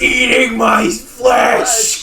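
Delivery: hammed-up terror; mock panicked shout. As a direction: wail it like you're being stung. Acting bee-attack panic.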